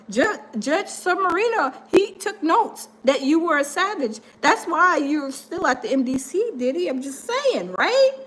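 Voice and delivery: normal talking voice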